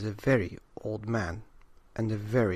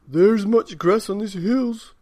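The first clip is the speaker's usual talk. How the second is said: put-on higher, louder, slow old man's voice; an old man offering the hills; 9 semitones higher, 11 dB louder than usual. A narrator's reading. Old man offers the cats grass, slow hearty voice. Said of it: "said the very old man".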